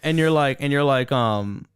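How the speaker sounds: ordinary talk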